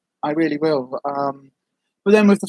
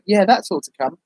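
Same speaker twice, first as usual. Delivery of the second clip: ordinary delivery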